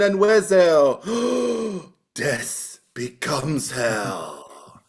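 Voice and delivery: dramatic trailer narration. Acted. Reads synopsis as trailer.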